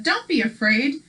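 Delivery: usual speaking voice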